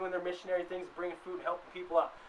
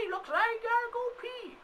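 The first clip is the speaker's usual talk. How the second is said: put-on old lady. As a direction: squeak it out like a scandalized granny. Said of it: "an old lady in the freaking audience going".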